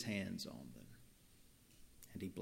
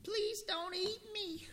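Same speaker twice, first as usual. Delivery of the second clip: squeaky mouse voice. Acted. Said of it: "squeaked the".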